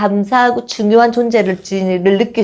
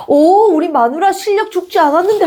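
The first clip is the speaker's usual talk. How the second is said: higher, playful praising husband; teasing dad; 9 semitones higher than usual. Retold or imitated. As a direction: tease your wife fondly. 아빠가 아내 춤을 칭찬. (아내를 치켜세우는 장난스러운 아빠 흉내)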